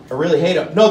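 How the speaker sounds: ordinary delivery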